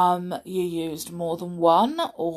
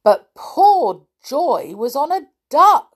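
animated narration, growing higher and louder; children's story reading; 6 semitones higher, 8 dB louder than usual. A narrator's reading. Reader tells children of runaway roundabout animals, animated storytelling. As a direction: build the wonder for young listeners.

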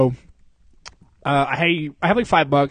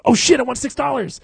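excited; 9 semitones higher than usual. Retold or imitated. Excited realization at small win.

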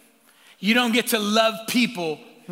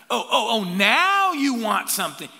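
sarcastic gotcha; 5 semitones higher, 6 dB louder than usual. Retold or imitated. Petty God voice.

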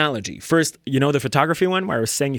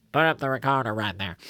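[name] impression; 3 semitones lower, 5 dB quieter than usual. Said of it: "that's my [name] impression".

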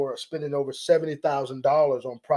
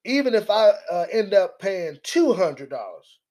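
higher, louder mock of her; mock defiance; 5 semitones higher, 5 dB louder than usual. Commentator mimics the congresswoman shrugging off the cost. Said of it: "That's how she said it".

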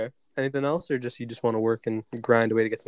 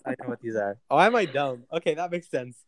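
ordinary talk, nothing marked

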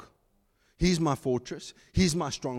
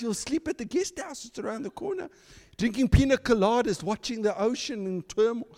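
much higher mock-sweet voice; mocking a comfy gospel; 8 semitones higher than usual. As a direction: sell a cosy holiday faith in a sugary voice. Parodying comfortable false gospel.

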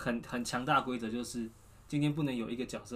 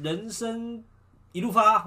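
cheerful new year wishes; 8 semitones higher, 6 dB louder, slower than usual. Acted. New year blessing, rising flourish.

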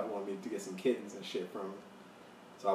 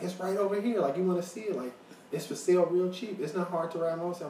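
higher, eager sales pitch; pushy stranger; 8 semitones higher, 4 dB louder than usual. Retold or imitated. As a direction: imitate a man coaxing too insistently. Acting the man's pushy sales pitch.

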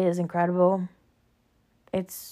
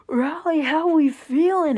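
high, loud hype-man call; hyping up a host; 8 semitones higher, 6 dB louder, slower than usual. Retold or imitated. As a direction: shout it like an emcee to the crowd. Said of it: "he would come out and be like".

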